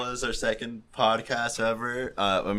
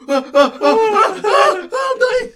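hammed-up high shouting ahs, much higher and louder; mock dying moans; 12 dB louder, much slower than usual. Friend retelling first time on podcast, hammed-up wailing. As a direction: play it as a laughing fake death wail. Retold climax, shouting laughing.

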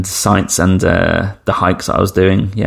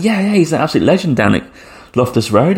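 high-pitched, eager endorsement; imitated fan enthusiasm; 7 semitones higher than usual. Friend vouching for the ex-player, excited retelling. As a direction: enthuse like an impressed football fan.

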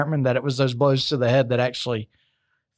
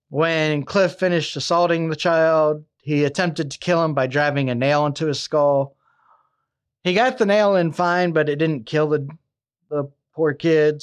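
usual speaking voice

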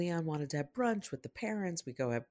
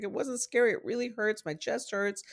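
lightly voiced upset child; a hint of a hurt little boy; 7 semitones higher than usual. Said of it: "He's like".

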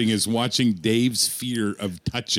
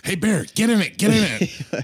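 teasing friends; 9 semitones higher than usual. Retold or imitated. Friends urging him into smart car.

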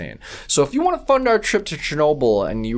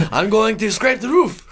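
a lightly put-on high Russian voice, joking; mock Russian worker; 10 semitones higher, 3 dB louder than usual. Podcast host jokingly voicing a Soviet worker, mock Russian voice. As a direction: play a Russian liquidator for laughs.

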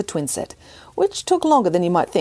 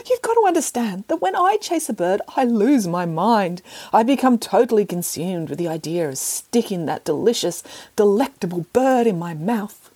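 snooty bored cat; 3 semitones higher than usual. Acted. Cat character voiced.

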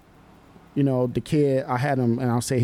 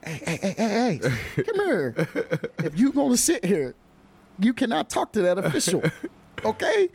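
laughing mock scolding; 7 semitones higher than usual. Coach scolding the kid, laughing.

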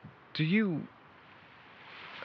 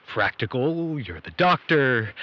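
normal talking voice

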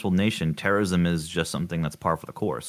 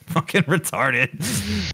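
laughing; 11 semitones higher, much slower than usual. Real, not acted. Laughing remark.

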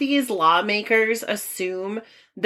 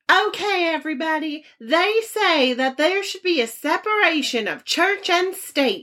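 high-pitched Southern US politician's speech; heinous Southern accent; 8 semitones higher than usual. Retold or imitated. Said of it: "sorry for my heinous Southern accent".

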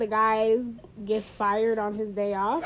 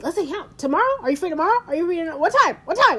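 higher, louder, insistent nagging; pushy eager person; 5 semitones higher, 3 dB louder, much faster than usual. Retold or imitated. Friend mimics pushy people demanding plans, higher. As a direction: nag for a time, rising insistence.

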